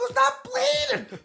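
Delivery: very high, slow, mocking echo of "not bleeding"; whiny mimicry. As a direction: parrot him back in a squeaky sneer. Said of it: "I go".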